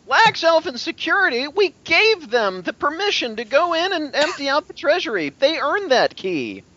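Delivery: high, loud indignant retort; a bystander shouting back, offended. Acted. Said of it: "You hear a voice from somewhere saying".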